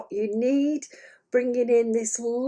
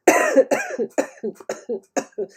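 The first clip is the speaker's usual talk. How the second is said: real chesty coughing fit; wheezy cough; 8 semitones higher than usual. Real, not acted. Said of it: "I'm a bit wheezy tonight".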